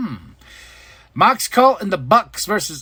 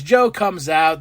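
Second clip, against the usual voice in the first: ordinary talk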